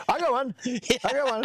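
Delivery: very high, eager repeated call; eager volunteer. Pretend. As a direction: shout it like a keen audience member.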